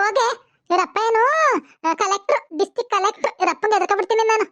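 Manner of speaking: ordinary delivery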